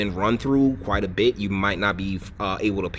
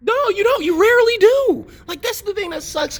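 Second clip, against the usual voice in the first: much higher and louder, taunting; mocking high voice; 6 dB louder than usual. Real, not acted. Host taunting his female cohost, mocking squeaky voice. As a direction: jeer at her in a squeaky mock voice.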